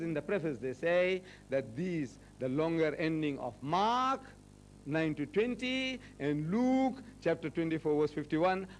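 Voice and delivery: ordinary talk, nothing marked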